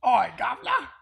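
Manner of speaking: loud, badly faked Cockney; Cockney accent. Host who can't do accents jokingly attempts loud Cockney. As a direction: belt out a hammed-up Cockney greeting. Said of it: "It's a great Cockney accent".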